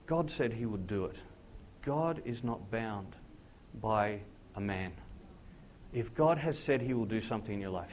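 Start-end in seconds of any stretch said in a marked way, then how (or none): 1.8-4.9 slow, deliberate sermon emphasis; slow emphatic point. Real, not acted. Preacher driving home the point to the congregation, slowly. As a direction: lay each word down slowly.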